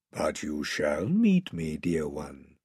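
low, slow, soft lion voice; tender reassurance. Acted. Lion comforting a sobbing child, tender. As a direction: comfort her quietly, deep and unhurried.

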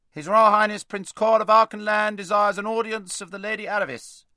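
high, loud monotone chant of a herald; herald's proclamation. A narrator's reading. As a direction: proclaim it formally at full volume. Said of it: "said the herald".

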